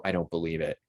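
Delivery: understated hushed skepticism; retold whisper. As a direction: quote it quietly, like an aside. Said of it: "when I whisper and I go".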